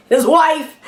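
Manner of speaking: high, loud burst of amusement; amused punchline. Real, not acted. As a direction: land it high and loud, about to laugh. Comic reveal ending in a laugh.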